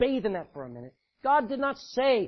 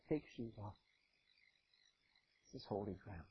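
much lower, soft holy command; solemn hush; 11 semitones lower, 16 dB quieter than usual. Acted. God quietly telling Moses to remove his shoes. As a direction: hush it like sacred ground.

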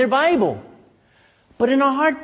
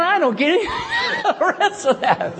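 laughing; 10 semitones higher than usual. Real, not acted. Preacher laughing at a paraphrase.

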